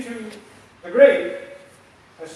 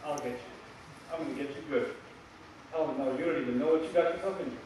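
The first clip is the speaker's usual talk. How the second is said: low, hushed menacing promise; a hint of a quiet menacing threat; 7 semitones lower, 9 dB quieter, faster than usual. Shown as a demonstration. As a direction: threaten them softly and darkly. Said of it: "when you are threatening someone".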